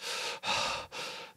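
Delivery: long whispered exhale; deep release breath. A narrator's reading. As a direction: breathe the pain out slowly. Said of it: "breathing deeply to release".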